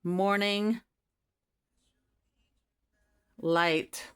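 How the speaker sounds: slow, dreamy recitation; slow reading of an engraved quote; reading aloud. Shown as a demonstration. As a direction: read it like a storybook line. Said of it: "I'll read it to you".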